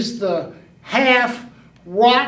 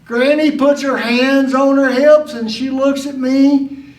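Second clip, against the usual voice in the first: ordinary talk, nothing marked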